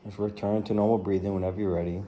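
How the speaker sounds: soft, measured meditation voice with long pauses; guided body scan. Real, not acted. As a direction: ease them down through the body, quietly and unhurriedly.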